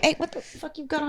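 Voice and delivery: mock-rude jab at a friend's outfit, laughing through it; blunt joking insult to a buddy; crude teasing jab. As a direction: bust on a friend, half laughing.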